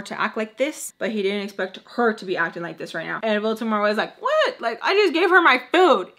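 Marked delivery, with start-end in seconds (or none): 4.2-6.1 high, loud mock outrage; squeaky exclaimed "what", then loud complaint; indignant disbelief. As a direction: imitate an affronted protest, pitch leaping up. Acts out indignant quote in story.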